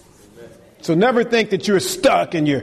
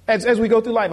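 ordinary talk

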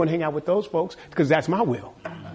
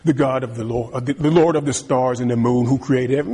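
pompous prayer parody; 7 semitones lower than usual. Pretend. Mocking long-winded prayer.

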